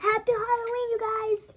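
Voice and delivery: high, loud cheerful call; excited greeting. Real, not acted. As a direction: call out to the viewers. Greeting viewers loudly.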